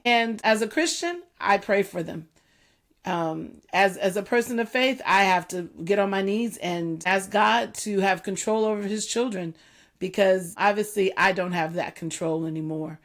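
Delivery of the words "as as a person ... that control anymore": usual speaking voice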